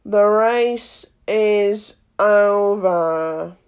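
very slow, drawn-out announcement; dodo calling out. A narrator's reading. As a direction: stretch it out like a grand proclamation. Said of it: "suddenly called out".

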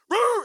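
loud, high mock bark; imitated dog bark. Preacher imitating the dog behind him, loud high bark. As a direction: bark like a big chasing dog.

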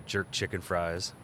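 usual speaking voice

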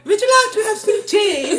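high, laughing mock-posh British English; mock British accent. Scene: mocking how British people talk, laughing British accent. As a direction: put on a prissy British accent through laughter. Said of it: "todo suena como tan".

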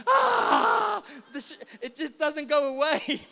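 high, loud yell of frustration breaking into a laugh; frustrated cry. Retold or imitated. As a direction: scream like the shampoo will never rinse out. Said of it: "cries out in frustration".

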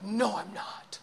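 whispered, defeated denial; reply under your breath, refusing the comfort; small, withdrawn whisper; hushed self-doubt. Acted. Acted self-doubting reply to Spirit.

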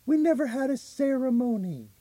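mock stepmother. Retold stepmother answering cops.

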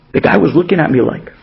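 ordinary delivery

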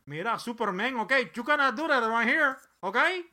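hammed-up Mexican-accented villain scolding; del Toro as Lex Luthor. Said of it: "Guillermo del Toro is my picks for Lex Luthor".

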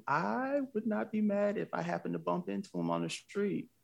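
high-pitched, playful admission; lightly voiced thought of a hopeful fan; coy inner thought. Voicing own starstruck inner thought.

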